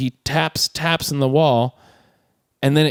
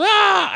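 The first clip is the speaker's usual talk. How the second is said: high mock scream of frustration; short, very high exasperated shriek; imitated frustrated yell. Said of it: "out of frustration, just goes".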